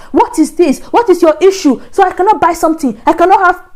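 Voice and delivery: retelling roommate's angry explosion at her; angry outburst. Retold or imitated. Roommate snapping about being monitored, furious high rant.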